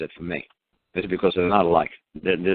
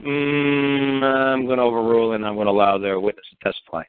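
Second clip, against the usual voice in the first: deliberating judge; 3 semitones higher than usual. Retold or imitated. Judge hums before overruling the prosecutor, deliberating. Retelling the judge's drawn-out ruling.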